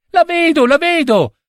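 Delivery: very high-pitched excited exclamation; little prince squealing with delight; joyful cry. A narrator's reading. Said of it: "si mise a gridare".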